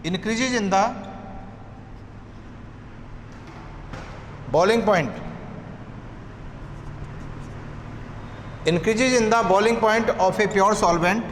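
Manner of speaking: slow, repeated phrases with long pauses; slow dictation. Shown as a demonstration. Teacher dictating elevation in boiling point, slow dictation. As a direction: dictate piece by piece so students can write. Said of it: "लिखिए".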